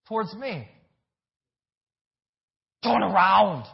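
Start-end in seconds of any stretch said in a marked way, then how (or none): 2.8-3.7 put-on loud Austrian-accented command; brief forceful put-on Austrian accent; mock Schwarzenegger accent. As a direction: throw in the Austrian action-hero accent, loud. Reprises the Arnold accent joke.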